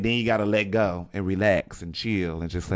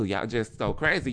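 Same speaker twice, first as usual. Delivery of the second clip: ordinary delivery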